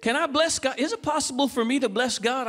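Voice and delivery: usual speaking voice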